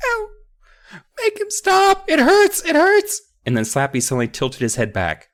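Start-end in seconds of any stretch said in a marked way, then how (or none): 0.1-3.2 put-on very high, pained child's pleading; high, heartbreaking wail. Child begging for rescue from Slappy, high wail. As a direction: wail it out, hammed-up agony. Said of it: "heartbreaking wail".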